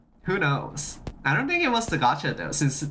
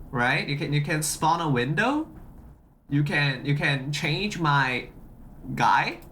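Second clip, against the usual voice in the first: usual speaking voice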